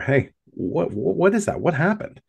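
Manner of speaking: high, urgent, rapid-fire questions; startled questioning. Acted. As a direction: shake her awake with alarmed questions. Waking daughter after the encounter.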